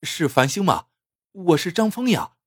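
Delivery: can't wait to say who you are; eagerly announcing yourself; impatient, eager. A narrator's reading. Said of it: "张峰迫不及待地说".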